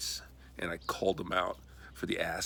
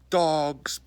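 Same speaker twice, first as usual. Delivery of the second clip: ordinary delivery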